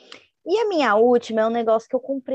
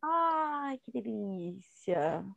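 pleased sigh; 9 dB quieter, much slower than usual. Retold or imitated. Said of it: "assim, eu falo".

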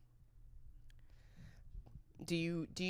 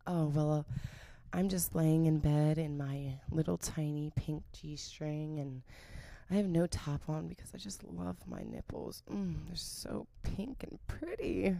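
sexy talk; 2 semitones lower, 4 dB quieter than usual. Pretend. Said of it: "You wanna be more like".

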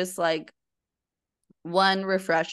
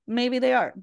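higher teasing repeat; playful concession; 6 semitones higher, 3 dB louder than usual. Real, not acted. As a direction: concede it with a grin. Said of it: "which maybe they are".

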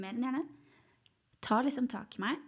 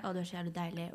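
mock whisper; 3 semitones lower, 6 dB quieter than usual. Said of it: "mannen hvisker ting i øret".

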